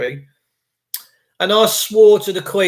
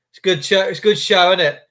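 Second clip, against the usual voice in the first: ordinary delivery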